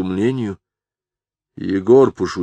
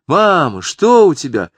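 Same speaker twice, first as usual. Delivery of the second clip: eager call; 9 semitones higher, 7 dB louder than usual. Acted. Daughter calls out eagerly.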